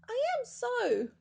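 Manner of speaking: high, loud squeaky protest; indignant little bear. A narrator's reading. Teddy protesting to the cleaning lady, high squeaky retort. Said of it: "growled little Teddy".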